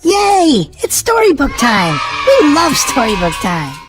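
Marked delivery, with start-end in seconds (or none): none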